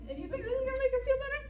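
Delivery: high, fast, loud indignant question; indignant disbelief. Real, not acted. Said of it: "I'm still mad about it".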